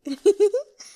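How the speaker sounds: high coquettish titter; a courtesan's put-on girlish laugh; girlish giggle. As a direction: giggle to charm him.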